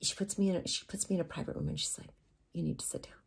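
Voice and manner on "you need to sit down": very soft, near-whispered order; hushed gravity. Retold or imitated. Agent to model in a private room, hushed gravity.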